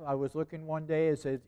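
usual speaking voice

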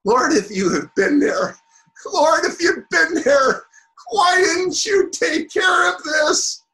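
rising, loud, tearful plea; anguished cry. Acted. Mary pleading with Jesus over Lazarus's death, anguished cry. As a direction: cry out to the Lord in grief, voice breaking.

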